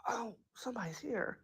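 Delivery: barely audible hushed quote. Retold or imitated. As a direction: say it almost silently. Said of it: "Nobody yells that".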